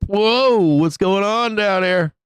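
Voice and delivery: high, drawn-out whoa and question; surprised. Real, not acted. Streamer spotting movement down on the chart, surprised. Reacting to the falling price chart.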